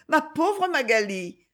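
high, slow, tender pity; little brother tenderly consoling his sister. A narrator's reading. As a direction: cuddle the words, slowly. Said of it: "en appuyant tendrement sa joue".

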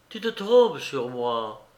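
ordinary delivery

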